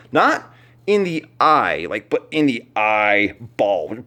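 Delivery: slow comic punch on "eyeball"; stress on eyeball. Acted. Comic correction eye vs eyeball.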